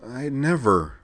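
a high, wavering protest; unsteady voice. Acted. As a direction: let the voice wobble as it rises. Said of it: "said the unsteady-sounding voice again".

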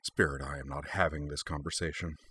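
low, soft muttered complaint; muttering through teeth. Acted. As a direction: grumble it under your breath through teeth. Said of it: "muttered through her teeth".

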